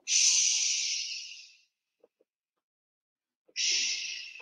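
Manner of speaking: soft unvoiced shush; hush. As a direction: hush the kids so the keeper won't hear. Curled up hiding still.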